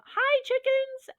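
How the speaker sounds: very high, drawn-out greeting; playful high-pitched holler; loud, goofy squeal. Acted. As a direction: squeal it slowly and loudly, being silly. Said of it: "maybe a little loud".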